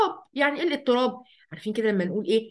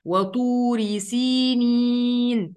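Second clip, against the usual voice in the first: والتين والزيتون drawn out slowly, chanted; slow recitation; much slower than usual. Acted. Recites verse.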